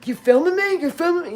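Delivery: high, loud, confrontational challenge; angry New Yorker. Retold or imitated. Angry New Yorker confronts the filmmaker, high and loud. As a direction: bark it like a stranger who caught a camera. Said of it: "they would be like".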